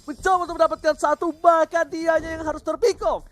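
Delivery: excited play-by-play. Real, not acted. Caster hypes a pick-off.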